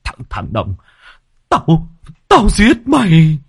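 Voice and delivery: stammering start bursting into a high, loud threat; furious high-pitched confrontation; teeth-gritted rage. A narrator's reading. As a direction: grit your teeth, then explode at him. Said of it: "nghiến răng nghiến lợi".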